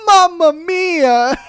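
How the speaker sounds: high, slow, loud Italian cry of mamma mia; Italian exclamation. Retold or imitated. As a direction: shout mamma mia like a shocked Italian guy. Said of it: "kept saying".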